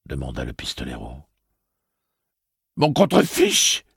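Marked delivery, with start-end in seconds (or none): 2.8-3.8 high, loud, slow defiance; despairing outburst. A narrator's reading. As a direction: fling it out in despair. Eddie in grief, defiant.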